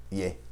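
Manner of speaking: "Ie" with a soft I-like start; "Ie" as some regions say it; regional letter name. Shown as a demonstration. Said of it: "Em alguns lugares pode ainda ser chamada de ie".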